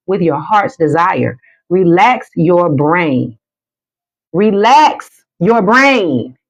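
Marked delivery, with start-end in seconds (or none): 1.7-3.4 slow, drawn out; slow soothing instruction; slow relax cue. Shown as a demonstration. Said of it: "One more time". 4.3-6.4 very slow and drawn out; slow soothing repeat; slower, higher relax cue. Shown as a demonstration. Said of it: "One more time".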